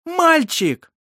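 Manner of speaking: high, loud joyful cry; triumphant shout. A narrator's reading. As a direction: announce the birth in triumph. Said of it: "торжествующе крикнула она".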